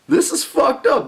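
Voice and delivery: high laughing outrage; laughing in disbelief. Real, not acted. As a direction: laugh it out, appalled. Outraged laugh at police story.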